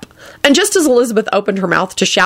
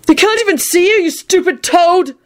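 exasperated; 12 semitones higher than usual. Acted. Neville scolding toad.

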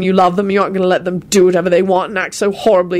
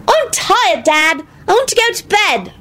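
put-on high, tired little-kid voice; childlike high-pitched complaint; whining child. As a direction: whine it like a sleepy child. Small son whining to his father.

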